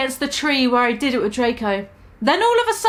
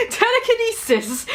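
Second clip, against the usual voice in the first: cruel line broken by laughter; 9 semitones higher, slower than usual. Acted. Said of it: "he answered cruelly".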